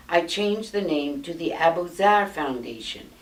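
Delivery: usual speaking voice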